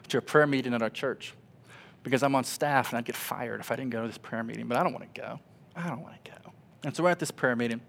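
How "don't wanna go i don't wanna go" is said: whispered, unwilling mutter; reluctant whisper. Retold or imitated. Staff pastor dreading the prayer meeting, whispered. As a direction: whisper it like you'd rather be anywhere else.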